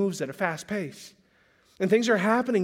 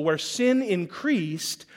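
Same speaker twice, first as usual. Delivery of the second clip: sin increased stretched out slowly; slow, weighty preaching repeat; slow emphasis; much slower than usual. Shown as a demonstration. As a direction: drag out "Where sin increased" word by word. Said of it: "slow emphatic repeat of verse".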